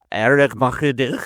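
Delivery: "Arithmetic" in a thick Scottish accent, slow and rising, with a rolled r and a throaty ch. Shown as a demonstration. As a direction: say "Arithmetic" the Scottish way, slowly: eh-reck-MAH-chih-dee. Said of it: "in a Scottish accent, I'd prefer".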